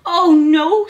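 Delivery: high, loud hedgehog squeak; little creature's loud dismay. A narrator's reading. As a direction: squeak it out in alarm. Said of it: "squeaked little Hedgehog".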